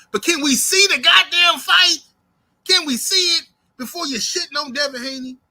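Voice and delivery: high, loud, exasperated rant at the fans; heated rant. Real, not acted. To Tank fans ducking the fight, a high loud exasperated rant.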